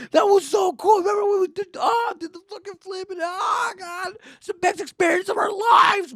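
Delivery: high-pitched shouted gushing; exaggerated thrill. Cousins bragging after the ride, shrieking with exaggerated joy. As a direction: gloat with hammed-up awe. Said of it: "They exaggerate their experience".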